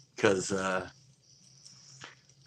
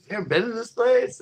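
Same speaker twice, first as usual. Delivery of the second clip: put-on drunk friend; 13 semitones higher, 4 dB louder than usual. Drunk friend asking him.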